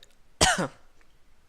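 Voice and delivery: violent cough. A narrator's reading. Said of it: "Arthur coughs up blood".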